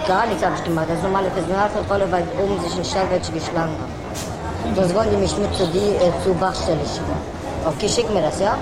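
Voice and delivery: speakerphone. Real, not acted. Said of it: "du bist auf laut".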